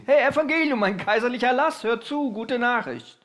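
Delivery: preacher mimicking a Roman herald proclaiming a decree; imperial herald. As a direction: announce it like a town crier to the crowd. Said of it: "um kaiserliche Erlasse anzukündigen".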